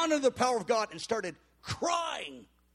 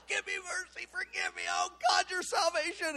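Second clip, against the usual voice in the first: imitated crying out; 10 semitones higher than usual. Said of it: "He's crying out loud".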